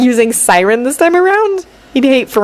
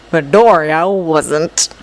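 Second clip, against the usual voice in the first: ordinary delivery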